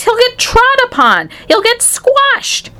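very high, drawn-out dread; alarmed protest. A narrator's reading. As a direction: warn in rising panic, stretching the last word. Father dreading son's fate at school.

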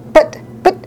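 high, stammered protest; shriek. A narrator's reading. Mother splutters at the factory owner, high stammering shriek. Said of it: "shrieked Missus Salt".

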